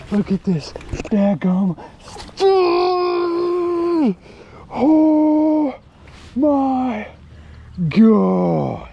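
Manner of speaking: high, stretched cry of joy; ecstatic cry. Real, not acted. Angler holding his biggest fish, ecstatic shout. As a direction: yell it out, near tears with joy.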